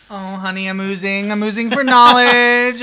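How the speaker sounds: loud, high, drawn-out camp moan; hammed-up sultry cry. Teasing her cohost with a loud campy moan. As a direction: vamp it up as if dripping with lust. Campy sexy reply to a joke.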